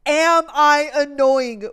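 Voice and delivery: loud, drawn-out, emphatic question. Retold or imitated. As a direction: say it loud and slow, spacing the words. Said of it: "I said".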